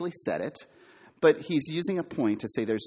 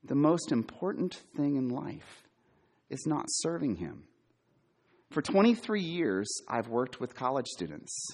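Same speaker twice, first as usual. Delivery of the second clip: ordinary talk, nothing marked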